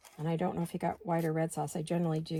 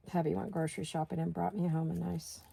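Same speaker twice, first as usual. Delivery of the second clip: normal talking voice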